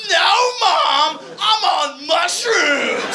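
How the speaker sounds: high, drawn-out shout at mom; loud protest. Retold or imitated. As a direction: yell it back, hammed-up. Son protesting to his mom.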